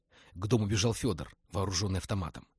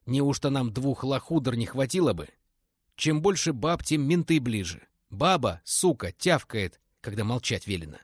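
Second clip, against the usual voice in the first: put-on grumbling old thug; 4 semitones higher, 5 dB louder than usual. Old thug scolds about women.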